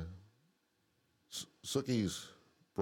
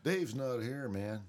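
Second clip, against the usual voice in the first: lightly voiced laid-back stoner, higher; 6 semitones higher, slower than usual. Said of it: "quote movie lines from [name] and [name]".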